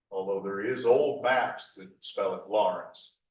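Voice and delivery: ordinary talk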